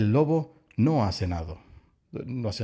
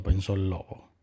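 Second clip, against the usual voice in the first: ordinary delivery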